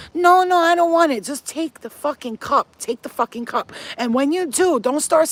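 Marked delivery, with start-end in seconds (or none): 0.1-1.2 high, loud mock protest; whiny refusal. Mimicking people who won't accept being spoiled, mock whiny protest. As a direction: imitate someone fussily refusing a gift.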